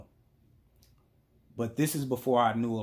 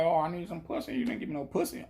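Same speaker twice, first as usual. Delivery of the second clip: higher-pitched imitation of moaning and begging; mock whining; 6 semitones higher, faster than usual. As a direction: lightly put on a complaining whine. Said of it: "bitch and moan and cry".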